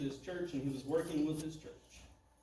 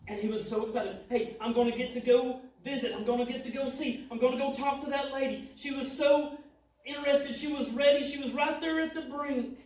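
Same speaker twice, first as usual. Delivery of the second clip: higher and louder, excited preaching; full-force preaching; 7 semitones higher, 8 dB louder than usual. Acted. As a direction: preach it out, rising and loud with excitement.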